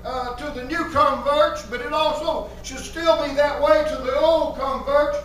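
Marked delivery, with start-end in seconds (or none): none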